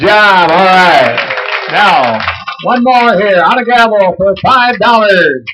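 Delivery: loud, high crowd hype; loud announcer. Real, not acted. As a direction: pump up the room. Hypes the crowd over music.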